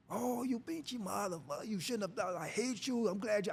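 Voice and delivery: high, strained mock rant without loudness; angry father. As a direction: imitate a father raging in grief. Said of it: "he was so angry".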